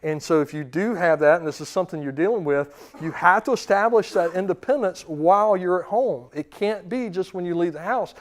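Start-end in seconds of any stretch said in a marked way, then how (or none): none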